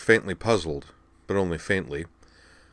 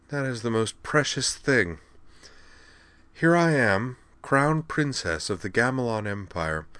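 lilting, slightly higher teasing voice; lightly voiced, amused royal patient; amused crown princess; 4 semitones higher, 3 dB quieter than usual. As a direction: marvel fondly at their naivete.

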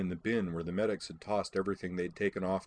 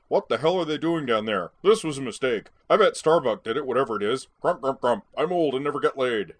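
loud, higher-pitched mocking grumble; hammed-up curmudgeon rant; grumpy old man impression; 6 semitones higher, 9 dB louder than usual. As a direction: mimic a cranky old officer complaining. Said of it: "before you do your Ty impression".

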